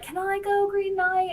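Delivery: high, eager asking voice; eager little Squirrel. Retold or imitated. Squirrel begging the Green Knight to come, high and eager. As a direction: pipe up high like an excited kid. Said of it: "Squirrel was like".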